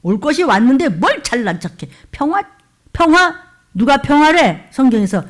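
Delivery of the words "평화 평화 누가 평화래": higher and louder, incredulous; scoffing challenge. Real, not acted. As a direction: throw the word back in disbelief. Scoffing at the word peace. (어이없다는 듯 높고 크게)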